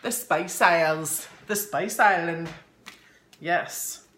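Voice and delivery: Nova Scotian accent. Real, not acted. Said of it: "My Nova Scotian accent here".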